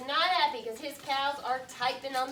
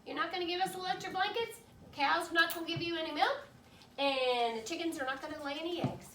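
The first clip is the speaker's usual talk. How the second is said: rapid recap; 3 semitones higher, faster than usual. Retold or imitated. Retelling cows' demands fast.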